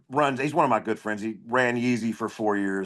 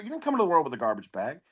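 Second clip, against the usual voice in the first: normal talking voice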